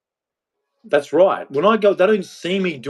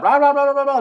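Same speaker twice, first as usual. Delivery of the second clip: high sing-song blah blah, climbing higher; mocking chatter; faster than usual. To the women he rants at, a high mocking blah blah. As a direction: parody her endless talking, pitched way up.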